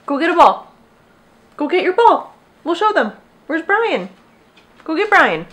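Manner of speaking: high, excited pet-talk voice; high-pitched commands to a pup; talking to dog. Real, not acted. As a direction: send the dog off to fetch in high, punchy bursts. Sends dog off to fetch toy.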